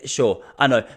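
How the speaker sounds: normal talking voice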